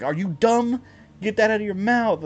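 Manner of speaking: high, loud exasperated scolding; scolding the dog. Retold or imitated. Owner arguing with his dog like a kid, loud scolding. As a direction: tell off the dog like a naughty kid. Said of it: "Me and him were arguing".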